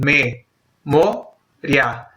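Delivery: "me, mo, ria" as three separate beats; syllables separated. Shown as a demonstration. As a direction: pause after each syllable of "me, mo, ria". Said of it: "porque yo las separo así".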